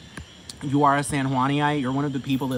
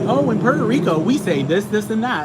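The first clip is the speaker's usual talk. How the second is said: lightly mimicked, higher and louder; mock spokesperson; 7 semitones higher, 6 dB louder than usual. Mimicking [name] talking up Puerto Rico, mocking.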